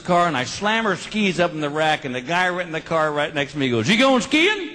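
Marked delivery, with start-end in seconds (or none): 3.9-4.7 high, dopey voice for the guy in the car; dumb question. Retold or imitated. Guy in next car asks a dumb question, dopey high voice. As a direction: play the clueless neighbor, pitched way up.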